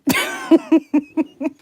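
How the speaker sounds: loud, high burst of laughter; real, helpless giggling; laughing. Real, not acted. Laughs at own French source.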